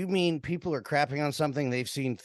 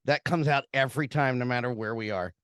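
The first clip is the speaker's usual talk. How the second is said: ordinary talk, nothing marked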